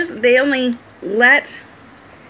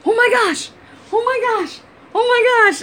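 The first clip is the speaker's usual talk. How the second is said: much higher, a yippy over-the-moon puppy; excited dog voice; 11 semitones higher than usual. Retold or imitated. Wife voicing their crazy dog Henry, high and overexcited. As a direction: voice the hyper dog bouncing everywhere, high and giddy. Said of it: "He's like".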